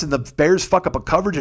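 ordinary talk, nothing marked

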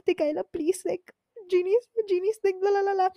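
very high mock-panicked babble; mimicked frantic pleading. Friend begs for help during class, high mimicked panic. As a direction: imitate a friend's high, desperate background pleading. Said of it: "you could hear in the background".

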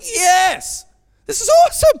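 high-pitched gleeful cheer; re-enacted childish delight; excited kid. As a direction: celebrate like a kid who just won. Acts out his childhood win.

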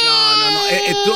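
mock scolding. Host scolds crying caller.

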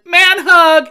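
high, loud, booming; loud cheerful call. Retold or imitated. Actor grabbing fan for photo, loud call. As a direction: shout it like a buddy grabbing you. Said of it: "he said".

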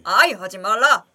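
loud embarrassed protest; cringing shout. Real, not acted. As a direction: yell at them to stop. Cringing at the bit.

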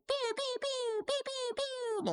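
very high, drawn-out pew pew noises; high mock blaster sounds; laser gun pews. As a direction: play at shooting laser guns.

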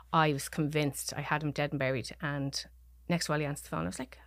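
normal talking voice

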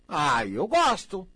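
loud, slow "ai, eu gosto"; delighted sigh. Acted. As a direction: savour it loudly and slowly. Prazer exagerado. ("ai, eu gosto" alto e lento, deliciado)

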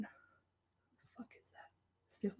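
baffled whisper to herself; under-breath whisper. Real, not acted. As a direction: whisper the question as you search your memory. Forgetting a name, muttering.

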